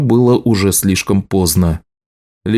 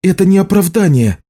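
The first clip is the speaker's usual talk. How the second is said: high-pitched angry outburst; indignant cry; 10 semitones higher than usual. A narrator's reading. Healer rejects the marked man's excuse, high angry cry. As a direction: cry out in outrage, pitched right up. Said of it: "крикнула Лиша".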